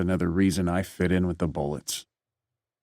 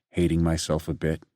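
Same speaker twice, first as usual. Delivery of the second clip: ordinary delivery, nothing marked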